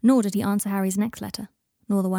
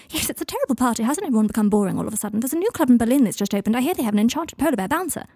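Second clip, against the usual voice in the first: fast, higher-pitched party babble; manic chatter; 3 semitones higher, 4 dB louder, faster than usual. Acted. Draco dodging Michael's despair, fast manic chatter. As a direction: rattle on brightly to change the subject.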